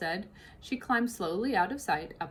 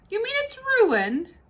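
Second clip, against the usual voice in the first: much higher and louder dismay; dismayed cook; 7 dB louder than usual. Acted. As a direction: crestfallen cook gasping the news. Mabel dismayed.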